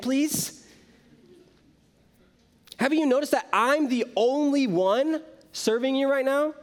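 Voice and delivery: high, pleading, indignant outburst at Jesus; an exasperated plea pitched high with frustration; stormy complaint. Retold or imitated. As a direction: burst in fed up and demanding help. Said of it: "She storms into the room".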